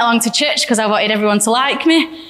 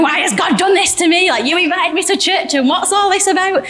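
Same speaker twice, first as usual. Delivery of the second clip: pitched-up, distressed questions; ringing her boyfriend, demanding why God did this, upset and higher; upset retold questioning; 7 semitones higher than usual. Upset questions to Adam on phone.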